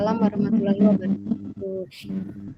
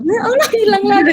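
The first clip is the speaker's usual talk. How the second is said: raised exasperated "Ya Allah"; 9 semitones higher, 7 dB louder than usual. Real, not acted. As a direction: sigh it out, fed up. Audio drops out again.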